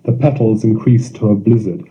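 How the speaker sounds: ordinary delivery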